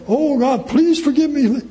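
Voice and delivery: mock pleading, high and loud. Preacher mimics someone begging God for forgiveness, exaggerated pleading. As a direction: beg loudly with pitch way up. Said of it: "I have to plead and say".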